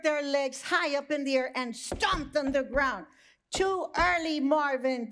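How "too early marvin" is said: neighing horses. Acted. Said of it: "the horses neighed".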